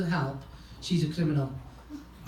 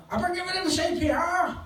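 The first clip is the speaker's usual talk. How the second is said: much higher put-on woman's voice; mock frantic woman; 13 semitones higher than usual. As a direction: imitate a frantic woman, pitched way up. Panicked woman quoted in story.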